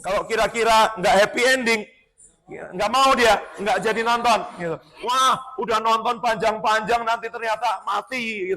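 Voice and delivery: menirukan protes, lebih keras dan tinggi; protes penonton. Retold or imitated. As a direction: tirukan orang yang ogah akhir sedih. Menirukan Mami menolak akhir sedih. (protes kecewa yang ditirukan)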